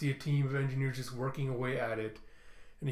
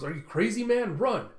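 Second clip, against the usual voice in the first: alarmed yell; 8 semitones higher, 6 dB louder than usual. Retold or imitated. Andy yelling at Tullus.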